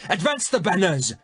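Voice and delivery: high shouted command; king ordering the banners to advance, shouting. A narrator's reading. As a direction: call the army forward. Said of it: "the king shouted".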